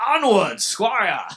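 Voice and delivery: very high, booming royal command; hammed-up king ordering his squire onward; entitled asshole king. Entitled app user dismissing the next face, kingly shout. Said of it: "like this entitled asshole king".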